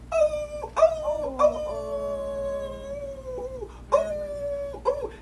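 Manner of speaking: very high, loud crying howl; coyote's howl heard overhead; howling wail. Acted. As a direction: let the howl carry far. Crow hears the howling.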